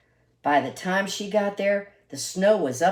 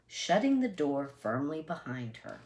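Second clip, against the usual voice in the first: ordinary delivery, nothing marked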